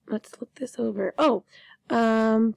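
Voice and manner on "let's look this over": softer whispered self-talk; quiet unvoiced murmur. Real, not acted. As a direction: whisper it to yourself while checking. Teacher checks work under breath.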